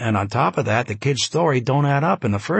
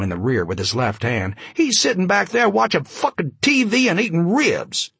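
ranting chained witness; 6 semitones higher than usual. Acted. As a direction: yell it at the cop, outraged.

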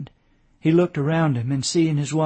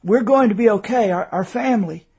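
higher, halting words with mild effort; effortful; 6 semitones higher, 5 dB louder than usual. A narrator's reading. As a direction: struggle to get the meaning across. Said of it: "he said with an effort".

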